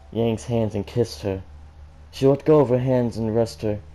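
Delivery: plain reading voice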